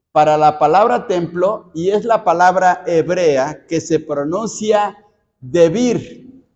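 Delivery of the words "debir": "debir" with the accent on the I; stress on final I. Shown as a demonstration. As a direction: say "debir" as de-BIR, stressing the last syllable. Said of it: "con el acento en la I".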